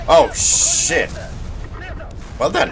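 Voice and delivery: sudden high, loud yelp; startled exclamation. Real, not acted. Gamer caught off guard, startled yelp. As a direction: jump at the surprise hit. Surprised by enemy in match.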